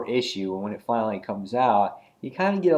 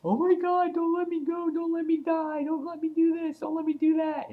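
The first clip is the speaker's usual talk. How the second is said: very high, panicked pleading; freaking out. Retold or imitated. Queen bee dangling off a ledge begs not to be dropped, panicked and very high. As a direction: beg for your life in a shrill squeak. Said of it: "the girl is freaking out".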